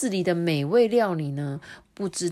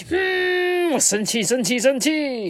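higher, slow pouting pot voice; sulking pot; 8 semitones higher, 5 dB louder, slower than usual. Acted. Pot shutting its lid, sulking. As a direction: sulk and pout.

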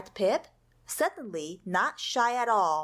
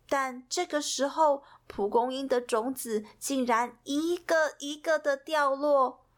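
plain reading voice

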